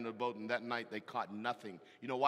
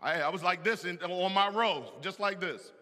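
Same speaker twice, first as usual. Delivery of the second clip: tough guard; 6 semitones higher, 8 dB louder than usual. Retold or imitated. Acting his stiff Marine self in church.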